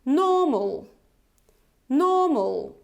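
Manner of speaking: "normal" without the R, schwa ending; British model word. Shown as a demonstration. Teacher reading the word for learners to repeat. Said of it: "let's read it together".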